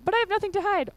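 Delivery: high, pinched mock of a naive citizen. Presenter mocks a surveillance excuse with a high, naive mock voice. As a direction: parody the nothing-to-hide line. Mocking a quote on the page.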